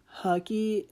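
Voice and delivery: ordinary talk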